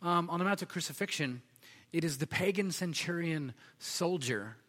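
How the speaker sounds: ordinary talk, nothing marked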